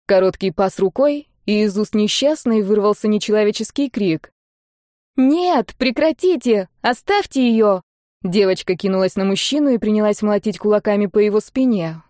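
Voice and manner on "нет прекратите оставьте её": put-on higher-pitched girl pleading; desperate protest. A narrator's reading. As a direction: plead for him to stop, voice raised in pitch. Girl begs lord to stop. (отчаянная мольба девочки)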